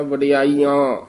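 ਵਡਿਆਈਆਂ with ਆਂ added nasalised at the end; nasal final syllable. Shown as a demonstration. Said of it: "ਵਡਿਆਈ ਬੋਲ ਕੇ ਆ ਬੋਲ ਦੇਣਾ ਹੈ".

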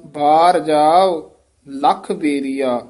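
slow, measured line resting after ਬੇਰੀਆ; deliberate teaching pace with a rest on ਬੇਰੀਆ; phrase-end pause. Shown as a demonstration. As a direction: draw out ਬਾਰ ਜਾਓ, then stop after ਬੇਰੀਆ. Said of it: "ਇੱਥੇ ਵਿਸਰਾਮ".

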